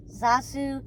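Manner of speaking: squawky talking bird; parrot voice. A narrator's reading. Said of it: "the bird chirped".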